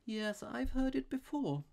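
high, slow absent-minded priest; vague manner. A narrator's reading. Priest unimpressed by the robber's trick, vaguely. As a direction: drift through it, high and slow. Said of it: "with the same strange vagueness of manner".

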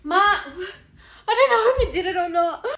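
high, loud and laughing; excited laughing call. Real, not acted. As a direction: call out to mom, giddy with excitement. Calling mother, laughing reveal.

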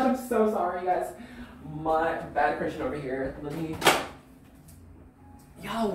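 ordinary talk

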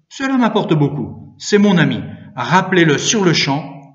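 loud, high, firm rebuttal; indignant insistence. A narrator's reading. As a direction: stand up for a friend forcefully. Priest argues with the sacristan.

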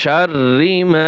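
শাররি মা slow, with a doubled র and a long final vowel; slow recitation. Shown as a demonstration. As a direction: stretch মা by one alif. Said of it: "এক আলিফ টান হবে". (ধীরে টেনে তেলাওয়াত)

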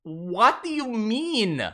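high, drawn-out bafflement; baffled disbelief. Real, not acted. Streamer reacting to a nonsense pronunciation clip, baffled. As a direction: stretch it slowly upward, then let it fall.

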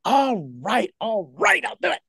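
retold reluctant consent, rising then dropping; exasperated giving in. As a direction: give in to a nag, mock-exasperated. Giving in to nagging inner prompting.

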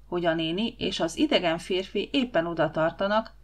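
ordinary delivery

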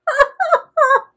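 loud, high cackle of delight; helpless laughter. Real, not acted. Reader tickled by a pun, loud real laughter.

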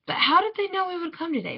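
father's puzzled question. A narrator's reading. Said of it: "my father asked".

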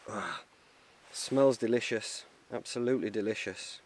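usual speaking voice

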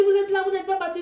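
high, startled question; surprised. Retold or imitated. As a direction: sound caught off guard. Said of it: "Il était surpris".

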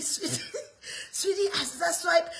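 giggling sweetie; laughing. Retold or imitated. Mother laughing with her daughter while paying. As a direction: laugh through the words.

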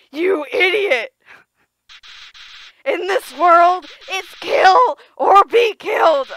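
loud, slow menacing snarl; evil Flowey. Acted. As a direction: spit it out as a villain. Flowey turns evil, read loud.

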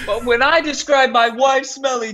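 high, laughing indignation; mock outrage. Husband complaining about unequal swearing rules, mock outrage.

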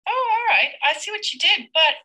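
high, bright oh of sudden understanding; surprised realization. Real, not acted. Teacher sees what the child spelled, surprised. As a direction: catch on in surprise.

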